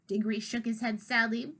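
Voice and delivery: ordinary delivery, nothing marked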